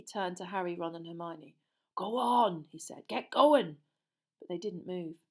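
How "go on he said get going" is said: loud, rough half-giant ordering them away; Hagrid's gruff voice. A narrator's reading. Hagrid sending the children back to the castle, loud and gruff. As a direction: boom it out like a big upset gamekeeper.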